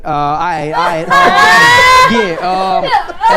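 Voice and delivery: high, drawn-out hyped yell of all right; loud, slow, high-pitched all right; excited shout. Retold or imitated. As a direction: stretch it out in a big excited holler. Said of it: "he's like".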